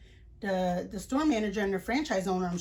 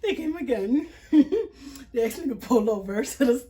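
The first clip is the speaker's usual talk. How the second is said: laughing while talking; 3 semitones higher, 6 dB louder than usual. Real, not acted. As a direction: tell it through bursts of disbelieving laughter. Laughs telling cops came again.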